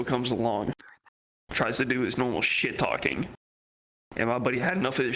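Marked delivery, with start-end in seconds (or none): none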